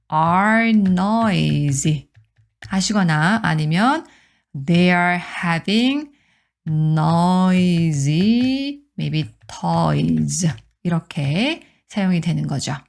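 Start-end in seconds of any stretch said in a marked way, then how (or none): 0.1-2.1 noisy said very slowly, word by word; slow, careful noisy; slow model reading. Shown as a demonstration. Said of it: "slow model reading". 4.7-10.5 They are having noisy said very slowly; slow, careful learner model; slow model reading. Shown as a demonstration. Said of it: "slow model reading".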